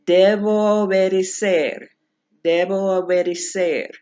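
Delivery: debo obedecer with the o's held a tad longer as one; linked vowel demo. Shown as a demonstration. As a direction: hang on the joined o of debo obedecer. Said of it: "going down to the third example".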